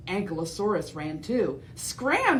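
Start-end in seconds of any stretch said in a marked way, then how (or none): 1.7-2.4 a high, sharp shout. Acted. Ankylosaurus shouting at Parasaurolophus to flee. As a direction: bark it out high. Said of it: "she shouted to Parasaurolophus".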